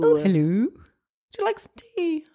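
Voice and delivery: high, drawn-out British hostess; exaggerated British accent, high and slow; mock British tea-party voice. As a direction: play at a dainty British tea offer.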